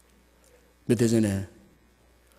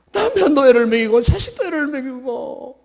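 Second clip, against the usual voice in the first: higher, louder daughter pouring out grievances; complaining daughter; 13 semitones higher, 7 dB louder than usual. Acted. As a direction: whine out her troubles to mom. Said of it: "친정 엄마한테 전화했어".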